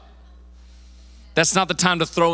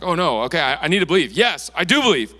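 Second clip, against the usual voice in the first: louder, hurried realization turning emphatic; crying out; 3 semitones higher, 5 dB louder than usual. Acted. Father realizing he must believe, crying out. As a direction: burst out, building to an emphatic yes. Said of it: "instantly cried out".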